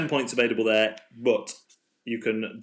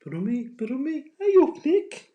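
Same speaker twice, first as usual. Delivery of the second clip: very high-pitched mock Birmingham accent; squeaky put-on Birmingham accent; 5 dB louder than usual. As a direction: warm up into an exaggerated Birmingham accent, louder on the greeting. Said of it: "Could be, uh, Birmingham. I have to get into that".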